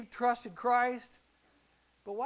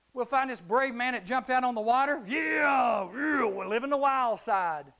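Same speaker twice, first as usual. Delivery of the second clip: loud, higher, excited shout; hyped shout; 4 semitones higher, 8 dB louder than usual. Acted. Preacher hyping the crowd about brave Peter, shouting. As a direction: pump the room up about Peter. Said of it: "preacher hypes brave Peter".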